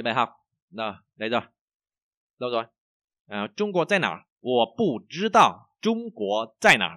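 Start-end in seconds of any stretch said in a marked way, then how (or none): 3.6-7.0 slow model reading. Shown as a demonstration. Teacher models Chinese sentences.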